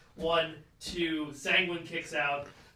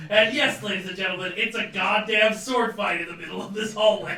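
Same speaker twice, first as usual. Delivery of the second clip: hyped announcer; 3 semitones higher, 7 dB louder than usual. Acted. Wrestling announcer hype.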